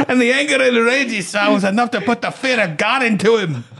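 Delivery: high-pitched hammed-up Scottish; Scottish accent. Garden owner warning of her rage, hammed-up Scottish. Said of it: "That's definitely Scottish".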